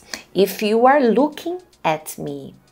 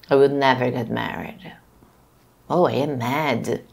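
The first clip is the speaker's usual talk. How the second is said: low, fast, mock-angry declaration; mock mad; 5 semitones lower than usual. Shown as a demonstration. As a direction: play it angry, lower and quicker. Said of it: "I would say".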